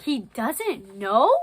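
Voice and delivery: loud, drawn-out incredulous question; play-acted amazed question; acted disbelief. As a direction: stretch it out in stagy astonishment. Wendy's astonished line in play.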